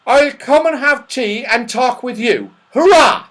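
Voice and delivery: put-on high, loud, eager old man; saucepan man. Saucepan man coming down for tea, cheering. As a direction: accept gleefully, ending in a cheer.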